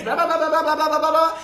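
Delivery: high, loud sing-song blah blah; mock the nonstop chatter. Mocks endless complaining.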